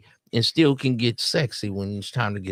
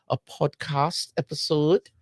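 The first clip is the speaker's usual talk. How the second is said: slow, savored British imitation, pitched up; imitated British podcast host; 5 semitones higher, 4 dB quieter, slower than usual. Reactor savoring the host's podcast episode, imitated British accent. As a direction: copy her British vowels, lingering on each word. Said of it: "I like the way she said podcast".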